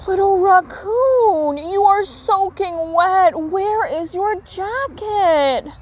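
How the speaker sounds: high, slow concerned parent; worried father. A narrator's reading. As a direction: scold gently but worried.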